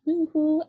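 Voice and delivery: high, loud woo-hoo; excited teacher cheer; cheerful whoop. Real, not acted. Cheer about literacy.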